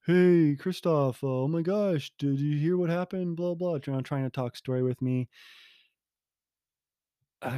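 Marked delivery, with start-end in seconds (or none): 0.1-3.8 higher, breezy mock neighbor; hammed-up chirpy greeting; chatty neighbor. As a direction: lightly imitate the friendly gossip. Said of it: "he's come up to me a few times like".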